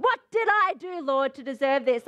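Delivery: indignant complaint thrown at the Lord; accusing, aggrieved question; accusation. Acted. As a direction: demand it as if wronged. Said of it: "it's an accusation against the Lord".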